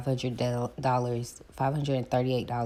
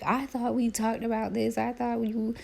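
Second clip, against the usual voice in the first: much higher scolding friend; high mock best friend; 11 semitones higher than usual. Best friend scolding her, high mock voice. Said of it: "He was like".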